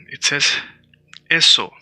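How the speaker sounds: ordinary talk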